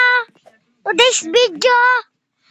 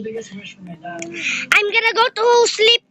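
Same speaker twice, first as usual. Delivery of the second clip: very slow sleepy voice; sleepy drawl; 2 semitones lower, much slower than usual. Pretend. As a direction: drag it out like you're dozing off. Sleepy drawn-out line. (inaantok, mabagal na pagsasalita)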